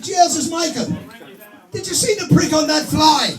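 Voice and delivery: a hammed-up Irish lad shouting to his mate; Irish accent. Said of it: "Two Irish fellas".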